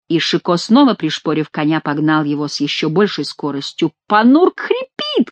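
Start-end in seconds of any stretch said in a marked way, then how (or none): 4.1-5.3 high, drawn-out cry. A narrator's reading. Monk calls out that his donkey is failing, crying out. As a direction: wail it slowly in high pitch. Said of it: "кричал Гранфло".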